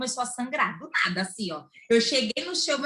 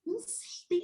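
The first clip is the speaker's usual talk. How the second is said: tiny, higher, very soft voice; hushed; 6 semitones higher, 8 dB quieter, slower than usual. Retold or imitated. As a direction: barely let it out, pitched up. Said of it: "Eu falando baixinho pra ele me escutar".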